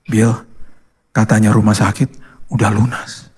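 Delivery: low, halting, understated emotion; retold tearful news. Tearful wife tells her husband the bill is paid, subdued. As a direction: break it slowly in small pieces, low. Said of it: "tiba-tiba dia keluar air mata".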